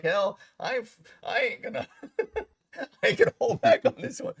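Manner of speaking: high voice breaking into laughter; laughing bravado. Retold or imitated. Actor recalling his nerve with the captain, laughing bravado. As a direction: laugh through the defiant words.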